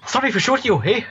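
high-pitched apologetic Canadian; mock Canadian accent. Player says sorry for shooting a teammate, mock Canadian accent. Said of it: "I don't do the accent".

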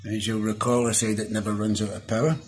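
usual speaking voice

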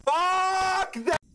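raging gamer. Real, not acted. Raging at the game.

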